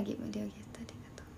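quick unvoiced whisper of thanks. Real, not acted. Whispering thanks to viewers with the window open. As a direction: whisper the thanks so the neighbours can't hear. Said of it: "だけん小声で喋らんといけん".

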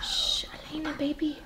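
hushed whisper, imitating a toddler; whispering over a crib. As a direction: whisper softly so the baby sleeps. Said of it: "that's what she's trying to say".

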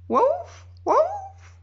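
very high, searching barks; calling bark. A narrator's reading. Dog after the avalanche calling for the girl with very high barks. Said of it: "appela-t-il".

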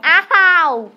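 high loud laughing pleas for help; loud pleading. Real, not acted. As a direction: call your sister for help, loud. Calling sister for help.

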